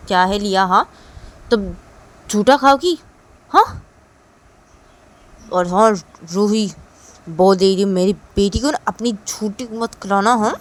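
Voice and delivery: loud, stern father telling off; scolding father. Acted. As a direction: scold with sharp questioning bursts. डाँटता हुआ पिता. (डाँटते पिता की तेज़ आवाज़)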